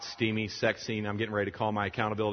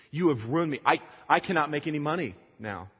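higher, louder mock accusation; retold angry yelling; 6 semitones higher, 5 dB louder than usual. Woman accusing the man who helped her, acted angry yell. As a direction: act out her yelling at him. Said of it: "she's crying and yelling and cursing at him".

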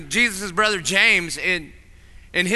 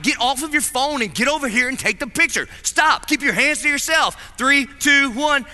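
higher-pitched, mock-stern family orders; exasperated dad; 7 semitones higher than usual. Dad to wife and kids at photo time, mock exasperation. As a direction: imitate a fed-up parent ordering everyone about.

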